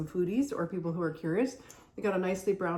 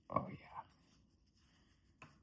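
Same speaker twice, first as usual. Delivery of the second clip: whisper; 14 dB quieter than usual. Real, not acted. Admiring cut cookies.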